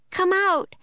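high urgent shout. A narrator's reading. Annie at the door calls them out, a high urgent shout. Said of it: "Annie shouted".

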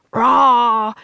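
loud gorilla roar. Acted. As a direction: roar like a silverback to scare off a leopard. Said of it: "he roared".

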